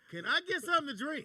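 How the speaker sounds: high, loud mock customer demand; pushy passenger. Passenger hitting the buzzer amid all this shit, pestering. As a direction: call up for service, oblivious to the crisis.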